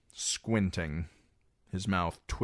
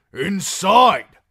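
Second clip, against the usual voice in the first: loud, high, falling command; shout; 13 semitones higher, 14 dB louder than usual. Acted. Guard yells at Reek to get inside, loud shout. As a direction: yell him in under the arrows. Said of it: "the voice shouted".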